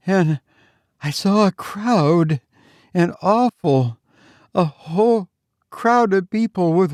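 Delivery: put-on high-pitched, terrified girl stammering; breathless fright, breaking between phrases; panting, breathless. A narrator's reading. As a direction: gasp it out in halting fragments. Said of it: "she panted".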